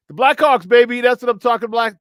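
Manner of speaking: loud hype for the win; excited cheer. Real, not acted. Host hyping the Blackhawks' win to listeners, loud and excited. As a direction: shout it like a fan. Celebrating last night's hockey win.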